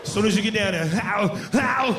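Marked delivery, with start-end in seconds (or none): 1.0-1.9 two drawn-out mock groans; imitated action-hero grunt. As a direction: grunt it twice like a movie strongman. Imitates the noise during sex.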